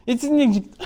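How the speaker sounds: mock slurred gabble; fast, garbled imitation. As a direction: imitate a colleague rattling it off unintelligibly. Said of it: "parle très vite et pas très articulé".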